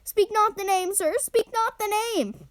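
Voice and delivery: high, frightened pleading moan; moaning in fear. A narrator's reading.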